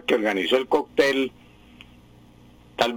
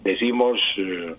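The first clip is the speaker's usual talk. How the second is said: normal talking voice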